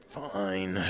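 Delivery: low, soft grudging fine; reluctant sigh. Acted. Big companion giving in to the player, low sigh. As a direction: give in with a sigh. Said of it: "He kind of sighs and goes".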